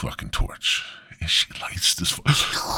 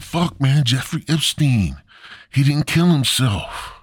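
raised, urgent conspiracy alarm; mock freaking out; 6 semitones higher, 3 dB louder than usual. Stoned Adam has a revelation, raised and freaked out. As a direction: blurt it in comic agitation. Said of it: "He was freaking out".

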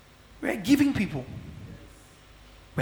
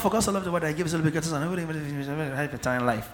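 rushed dismissive recital; 4 dB quieter, much faster than usual. Shown as a demonstration. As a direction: rattle the verse off very fast, skipping over words. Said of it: "So it's not".

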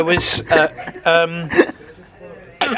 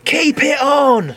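high, drawn-out heckler imitation; mock crowd heckle; 6 semitones higher, slower than usual. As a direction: stretch it out like a chanting audience. Audience heckles about fur hat.

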